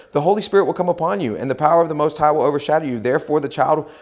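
loud, fast angelic proclamation; rapid scripture recital. Acted. Reads out the angel's answer.

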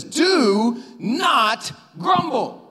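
very slow, word-by-word imperative; emphatic command. Retold or imitated. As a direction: spell out "Do not grumble" like an order. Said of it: "grabbing you by the metaphorical lapel and saying".

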